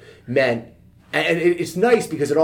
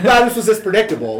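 laughing through a wry quip, higher and louder; amused, wry self-mockery; 10 semitones higher, 8 dB louder than usual. Telling the host about the flood of messages, laughing.

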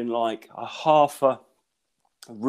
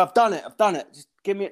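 higher-pitched, hurried-sounding retold protest; flustered protest; 8 semitones higher than usual. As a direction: act out fending off the test staff. Acted flustered exchange with test staff.

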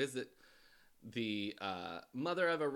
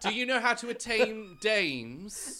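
slow, loud, hammed-up English accent; mock English accent; 9 semitones higher, 12 dB louder, slower than usual. Asking a sir about dames, mock English accent. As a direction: put on a big English accent. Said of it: "Oh, they're English".

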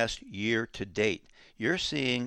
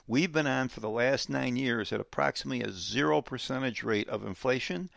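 normal talking voice

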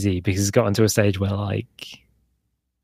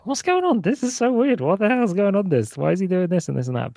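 high, baffled self-talk; talking to myself; 9 semitones higher than usual. Retold or imitated. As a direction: puzzle aloud to yourself, high and bewildered. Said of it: "talk to myself, like".

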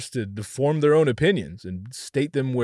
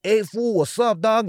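put-on loud, higher Chicano homie accent; Cheech Marin; 8 semitones higher, 4 dB louder than usual. The not-so-cool Spanish accent example, Chicano homie accent. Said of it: "Like fucking Cheech Marin and shit".

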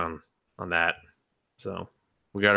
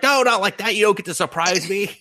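high-pitched, louder mock outrage; affronted cat; 12 semitones higher, 4 dB louder than usual. Cat scolds the man for patting him, high indignant protest. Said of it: "and he's just like".

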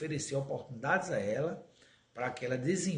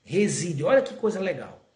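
solemn quotation; 4 semitones higher, 7 dB louder, slower than usual. Acted. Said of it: "a Meimei diz".

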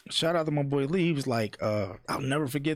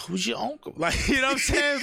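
a hint of [name], higher and sing-song; lightly voiced [name]; 9 semitones higher than usual. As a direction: tease the question in a sing-song lilt, laughing. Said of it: "could you do it in Jay's voice".

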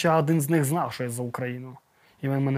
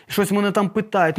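ordinary talk, nothing marked

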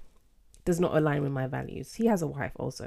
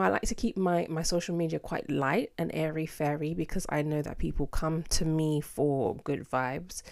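ordinary delivery

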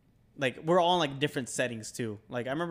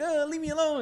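put-on pleading scared kid; 13 semitones higher than usual. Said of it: "And, like".

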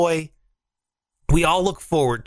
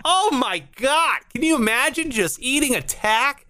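horrified disbelief; 9 semitones higher than usual. Retold or imitated. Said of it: "I'm like".

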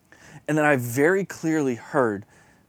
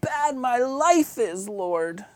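put-on self-pitying moan to God; hammed-up pitiful plea; mock whiny complaint; 8 semitones higher, slower than usual. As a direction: whine it like a guilt trip. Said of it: "guilt him enough of".

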